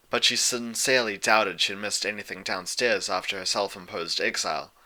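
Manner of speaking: usual speaking voice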